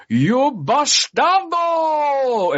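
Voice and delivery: hammed-up Italian villain shout, long drawn-out bastardo; mock Italian accent, stretching bastardo high and slow; awful fake Italian accent. Retelling the villain's last line in a terrible Italian accent. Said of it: "just the most awful accent you've ever heard".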